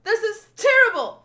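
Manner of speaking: high, loud panic; frantic shout for help; distraught shouting. Acted. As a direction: wail it out in horror. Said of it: "he's shouting for Luffy".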